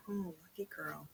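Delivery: plain reading voice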